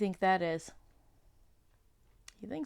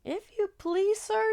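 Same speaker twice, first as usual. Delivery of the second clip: trembling; 13 semitones higher than usual. Acted. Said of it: "trembling so that he could hardly speak".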